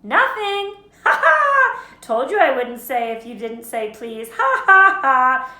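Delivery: high, loud cackling taunt; shrieky triumphant teasing; gloating Peeves. A narrator's reading. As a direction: crow over the trick with big fake laughs. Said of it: "in his annoying sing-song voice".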